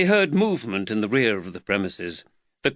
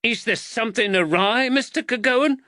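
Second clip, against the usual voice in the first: high-pitched indignant woman; angry wife's voice pitched right up; 13 semitones higher, 3 dB louder than usual. A narrator's reading. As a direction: throw the question back, high and angry. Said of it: "said the angry woman".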